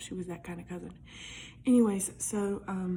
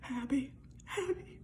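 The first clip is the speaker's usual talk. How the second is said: retold scared call; 5 semitones higher, 5 dB quieter than usual. Waking cousin in fear.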